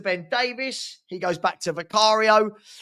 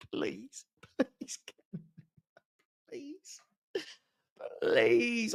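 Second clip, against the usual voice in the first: pleading please; 3 semitones higher, 11 dB quieter, much slower than usual. Real, not acted. Begging for a new manager.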